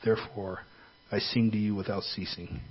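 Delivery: soft, earnest prayer voice. Acted. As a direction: pray quietly and earnestly. Leads a prayer.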